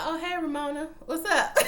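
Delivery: caught-out nervous chuckle; high, guilty laughing greeting; sheepish laugh. Retold or imitated. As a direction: laugh it off, busted. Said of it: "He's like".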